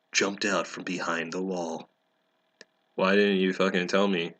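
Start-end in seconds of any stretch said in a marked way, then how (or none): none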